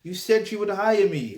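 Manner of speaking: re-enacted outrage, pitched high; indignant inner reaction. Man replaying his reaction to work-experience place refusing him, indignant. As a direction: voice your disbelief high, as remembered indignation. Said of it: "I'm thinking".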